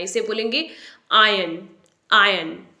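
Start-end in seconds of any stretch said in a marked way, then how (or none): 1.1-2.7 आयरन as AI-yun, silent r; correct pronunciation. Shown as a demonstration. Teacher modelling for learners, आयरन as AI-yun. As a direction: drop the r in आयरन. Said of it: "आर को pronounce नहीं करना है".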